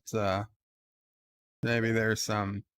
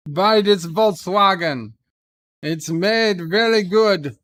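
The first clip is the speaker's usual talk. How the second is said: put-on loud, higher-pitched German-accented sales pitch; German car salesman; 4 semitones higher, 5 dB louder than usual. Streamer doing a requested German car salesman impression, loud hammed-up accent. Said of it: "can you do a German car salesman impression".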